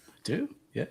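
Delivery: ordinary delivery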